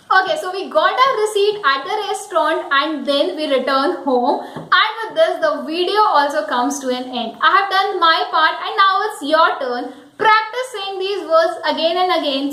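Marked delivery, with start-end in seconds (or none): none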